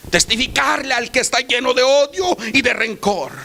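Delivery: sermon crescendo on testificarle; building preaching. Acted. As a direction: climb with each repetition. Repeated list building to a climax.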